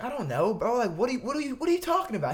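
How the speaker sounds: high-pitched, stammering bafflement; retold exasperation. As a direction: act out being fed up with a pest. Retold argument with pestering fan.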